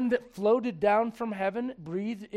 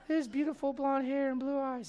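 high-pitched mock adoration; swooning girl; 6 semitones higher than usual. Princess Bride fan admiring the farm boy, mock swoon. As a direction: gush in a high, lovestruck put-on voice. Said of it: "every girl that's ever watched Princess Bride".

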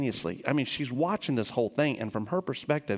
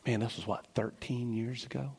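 choked up; 3 semitones lower, 5 dB quieter than usual. Real, not acted. Emotional recollection, voice drops.

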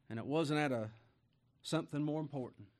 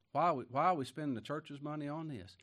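usual speaking voice

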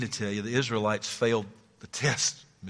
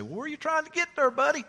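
folksy attendant; 3 dB louder, faster than usual. Retold or imitated. Preacher voicing a gas station attendant to a lost driver. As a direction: play the amused local.

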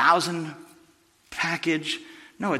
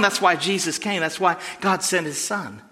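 normal talking voice

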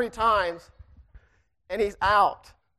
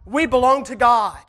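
louder, higher-pitched preaching to the congregation; 4 semitones higher, 4 dB louder than usual. Acted. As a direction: lift the church with a rising sermon. Preaches to the church.

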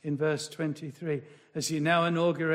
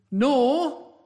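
"nor" punched, higher and louder; stressed nor; 6 semitones higher, 7 dB louder than usual. Shown as a demonstration. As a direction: throw weight on "nor" as another negative. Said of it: "there's another negative".

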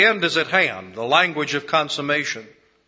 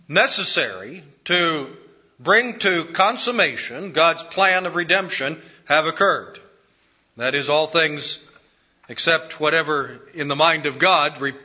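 ordinary delivery

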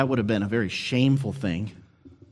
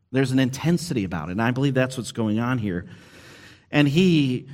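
normal talking voice

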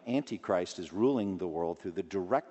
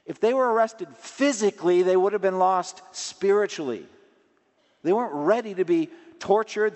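raised, ringing sermon voice, higher and louder; preaching at full force; 8 semitones higher, 6 dB louder than usual. Acted. Pastor urging the congregation to flee temptation, preaching loudly. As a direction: drive it home from the pulpit. Preaching why disciples fled.

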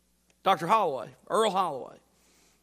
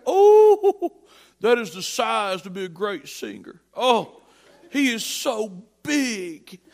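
higher, louder, laughing with delight; delighted proper old man; 6 semitones higher, 9 dB louder, slower than usual. Retold or imitated. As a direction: gush over the big singer. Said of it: "He's like".

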